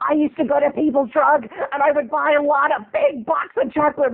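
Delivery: put-on gabby mother telling an old story; mom reminiscing. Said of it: "My mom's like".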